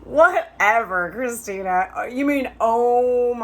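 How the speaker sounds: hammed-up mock from the friend; mocking scoff. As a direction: tease her like a skeptical old friend. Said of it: "he started to laugh at me".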